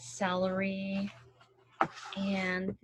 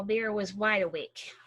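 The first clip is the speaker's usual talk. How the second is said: ordinary delivery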